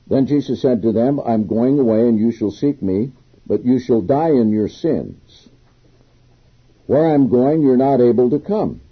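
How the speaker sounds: reading the Bible passage aloud with weight; scripture reading. Acted. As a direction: proclaim the verse to the congregation.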